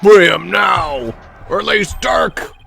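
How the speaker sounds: shouting. Acted. Shouting crowd voice in game.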